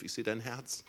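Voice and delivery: ordinary talk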